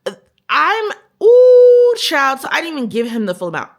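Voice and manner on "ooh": high, loud, held "ooh"; exasperated "ooh". Real, not acted. Venting about the date who underpaid, exasperated high squeal. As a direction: let out a sustained high ooh of outrage. Exasperated noise while ranting.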